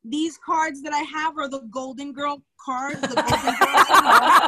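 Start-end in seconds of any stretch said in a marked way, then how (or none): 3.0-4.5 laughing through the words; amused, laughing as she says it. Real, not acted. Laughing while naming cards.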